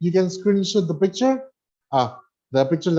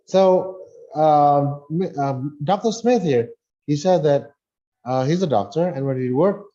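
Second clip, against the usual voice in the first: usual speaking voice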